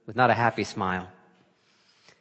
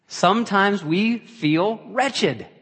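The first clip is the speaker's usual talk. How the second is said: higher, louder, slow and weighty; slow emphatic climax; 10 semitones higher, 8 dB louder, much slower than usual. Acted. Preacher urging the congregation, slow and loud. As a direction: lean hard on every word.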